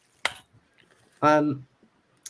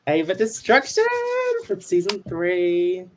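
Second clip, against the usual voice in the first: announcer voice; 7 semitones higher than usual. Acted. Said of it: "Official bio".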